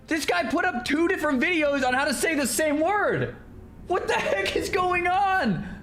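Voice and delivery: baffled, voice way up; incredulous. Real, not acted. Streamer incredulous that one guy made two videos. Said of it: "disbelief at two videos".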